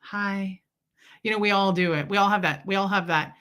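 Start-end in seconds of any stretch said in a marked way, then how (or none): 0.0-0.6 soft flirty hi for a tall guy. Shown as a demonstration. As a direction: toss a shy come-on hi. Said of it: "I'm like".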